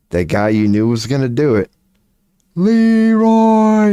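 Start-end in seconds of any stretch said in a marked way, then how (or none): 2.6-3.9 high, loud charging yell of the name; Leroy battle cry. Retold or imitated. Raid buddy charging in alone, high loud yell. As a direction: belt out the name as you rush in.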